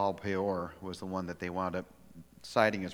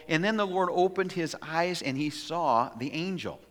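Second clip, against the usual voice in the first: ordinary talk, nothing marked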